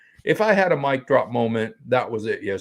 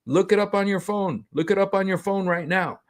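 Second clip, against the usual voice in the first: higher-pitched, insistent retold instruction; urging wife; 7 semitones higher than usual. Imitating wife telling the kids to check, insistent and higher. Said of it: "and she said".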